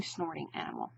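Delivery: usual speaking voice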